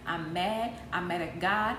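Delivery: ordinary delivery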